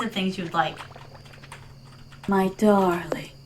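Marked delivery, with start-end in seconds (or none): 2.3-3.3 slow, low whisper; drawn-out whispered endearment; intimate whisper. Pretend. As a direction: whisper it slowly as a sweet aside. Flirty aside to viewers.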